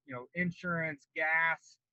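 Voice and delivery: ordinary talk, nothing marked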